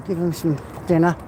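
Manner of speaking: usual speaking voice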